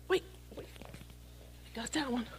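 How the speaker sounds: hushed, slow, breathy-free whisper; whispered aside. Real, not acted. Preacher whispering to himself as he grabs an object. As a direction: drop to a whisper to yourself.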